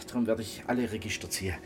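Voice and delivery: ordinary talk, nothing marked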